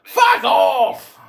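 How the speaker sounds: loud, furious yell of fuck off; angry shout. Real, not acted. Angrily shouting down the rival in the clubhouse, furious yell. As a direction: bark it out at him full volume.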